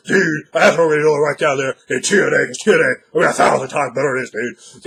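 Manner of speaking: loud, hammed-up wrestling promo; ranting wrestler voice. Shown as a demonstration. Wrestler bragging about T N A, loud rant. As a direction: keep the volume up like a wrestler's promo. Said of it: "go right into a voice like this".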